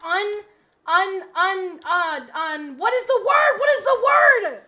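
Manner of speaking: loud, high exasperated yell; fed-up yelling question; frustrated shout. Real, not acted. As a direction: shout it at yourself. Streamer can't find the word.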